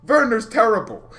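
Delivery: loud, high outburst of disgust; angry outburst. Real, not acted. Fan ranting about Timo Werner, loud and angry. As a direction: blurt it out loudly, fed up.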